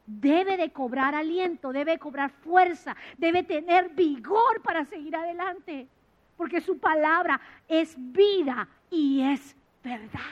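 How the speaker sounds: slow, loud insistence on debe; emphatic preaching. Shown as a demonstration. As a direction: drive home debe de cobrar aliento with weight. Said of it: "repeated louder, slower".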